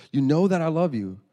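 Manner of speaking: high, loud acted insistence; exasperated. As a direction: protest like a fed-up daughter. Girl fed up with repeated question.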